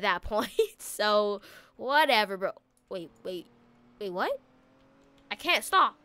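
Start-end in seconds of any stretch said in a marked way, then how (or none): none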